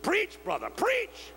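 high, shouted call of a deacon; a deacon calling out to the preacher, excited high yell. Retold or imitated. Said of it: "they yell".

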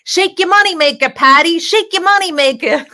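high, loud, gleeful yell across a bar; screamed heckle. Retold or imitated. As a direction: scream it out to someone dancing across the room. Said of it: "and I scream".

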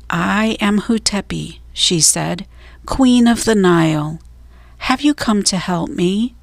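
plain reading voice

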